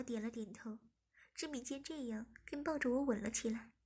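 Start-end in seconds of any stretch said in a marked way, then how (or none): none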